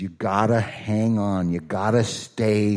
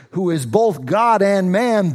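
usual speaking voice

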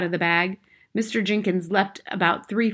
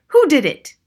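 loud, high, falling question; demanding cry; 8 semitones higher, 10 dB louder than usual. A narrator's reading. Father demanding who changed his son, loud cry. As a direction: demand it at a shout. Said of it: "he cried".

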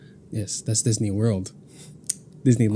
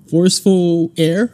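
slow, high and louder; quoting a friend; 6 semitones higher, 9 dB louder, slower than usual. Retold or imitated. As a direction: lightly mimic her earnest answer. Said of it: "She said".

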